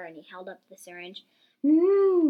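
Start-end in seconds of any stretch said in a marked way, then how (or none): 1.6-2.3 loud, high moaning moo; long high cow moan. A narrator's reading. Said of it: "moaned Caddy".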